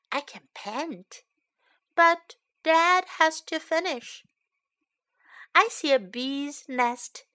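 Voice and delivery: put-on lively storybook read-aloud for kids; picture book read to young learners; reading to children. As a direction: read it brightly to little listeners.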